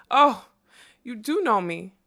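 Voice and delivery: loud, high burst on the first word; delighted cry. A narrator's reading. Unicorn hearing her name spoken, crying out delightedly. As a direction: cry it out with happy surprise. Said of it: "she cried".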